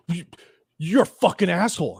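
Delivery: imitating a man stuttering into an insult; angry stammering. Enraged customer insults narrator, stammering mock anger. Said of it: "stammering, and he's just like".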